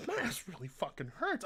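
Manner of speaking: normal talking voice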